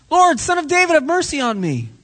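high-pitched cry for mercy; pleading shout. Acted. Blind man calling to Jesus again, pleading shout. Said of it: "And he's shouting".

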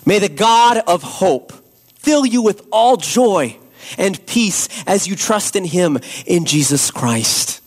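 ordinary talk, nothing marked

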